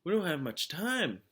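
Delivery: teacher urging the sheriff; urgent. A narrator's reading. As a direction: press him to hurry. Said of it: "urged Catherine".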